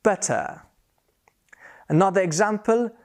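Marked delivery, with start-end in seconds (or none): 0.0-0.6 British better. Shown as a demonstration. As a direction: drop the R in better, British style. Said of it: "in British English we say".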